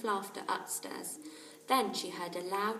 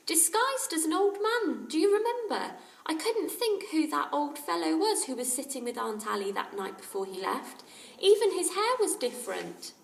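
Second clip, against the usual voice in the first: put-on high, loud young girl's chatter; a lively young girl, eager; 9 semitones higher, 7 dB louder than usual. A narrator's reading. Lucy Ann telling the others about the disguise, eager girl.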